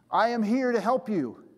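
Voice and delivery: high, projected voice from on high; mildly booming heavenly announcement; voice from above. Acted. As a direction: call it down from above. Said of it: "there's a voice from above".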